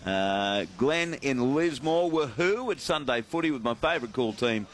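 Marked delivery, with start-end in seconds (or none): none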